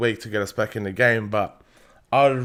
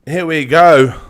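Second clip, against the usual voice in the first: loud, high "here we go" as the match nears; excited shout; 4 semitones higher, 12 dB louder, slower than usual. Real, not acted. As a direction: call it out, buzzing. Kickoff approaching, excited.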